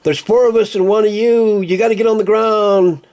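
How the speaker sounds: high, loud bully cop voice; mocking police shout. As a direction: bark it like a cowardly cop.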